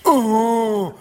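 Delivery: loud, high orc battle howl; mock orc roar. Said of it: "orc looking mean and scary".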